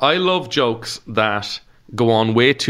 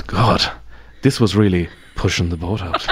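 italic Irish understatement; 3 semitones lower than usual. Retold or imitated. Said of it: "you read it in that voice".

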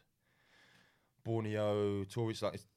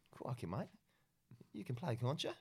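higher-pitched mock Australian, rising at the ends; Australian accent; 6 semitones higher, 4 dB quieter than usual. Retelling his agent's invitation to play, Australian accent. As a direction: put on your best Australian accent. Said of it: "this is my best Australian accent".

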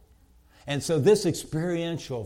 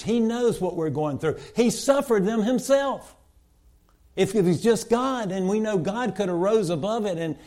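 higher and louder, building sermon climax; preaching at full force; 7 semitones higher, 7 dB louder than usual. Acted. Preacher to congregation on Jesus' suffering, full-force preaching. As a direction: drive it home to the congregation.

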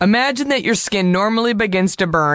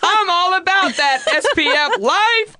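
sassy excitement; 9 semitones higher than usual. Real, not acted.

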